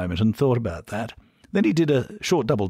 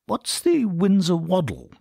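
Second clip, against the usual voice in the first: posh Englishman; 10 semitones higher, slower than usual. A narrator's reading. Bernard asks, posh.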